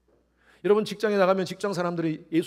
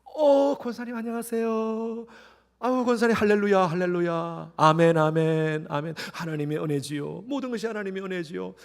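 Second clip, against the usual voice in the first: sugary phone voice; 4 semitones higher, 4 dB louder than usual. Retold or imitated. Mother answering the deaconess's call, sugary polite. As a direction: switch to sweet pious politeness. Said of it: "권사님한테 전화가 왔어".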